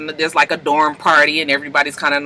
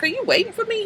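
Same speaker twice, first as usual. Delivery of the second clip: put-on high, soft girlish voice for her; lightly voiced shy young woman; voicing her shyly asking if he waited; 7 dB quieter, faster than usual. She asks Bojan, voiced high.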